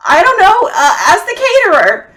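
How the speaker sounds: high, loud put-on child voice; a harried kid tossing it off. Child answering mom from the background, high and loud. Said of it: "sorry, that was terrible, Lily".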